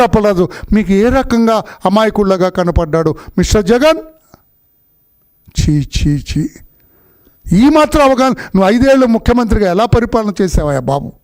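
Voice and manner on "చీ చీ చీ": low scornful tutting, dropping away; disgusted tut. Real, not acted. Disgusted leader scoffing at the claim, low scornful tuts. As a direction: spit out a disgusted "chee chee chee".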